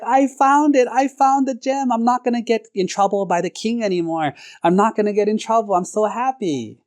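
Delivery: high-pitched gleeful celebration; acted jubilation, starting very high and easing slightly; overjoyed. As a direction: squeal it out with relief and delight. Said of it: "he was so happy".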